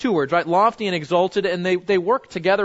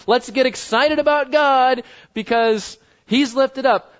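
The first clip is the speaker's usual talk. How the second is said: higher and louder, a hyped-up cheer; mock pep rally cheer; 6 semitones higher, 4 dB louder than usual. Preacher mocking pep-rally worship of God, hyped cheer. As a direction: ham up shallow pep-rally excitement. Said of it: "sometimes that sounds like a pep rally".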